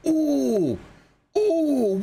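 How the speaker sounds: high excited ooh; surprised ooh. Real, not acted. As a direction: squeal of surprise. Sub alert surprise.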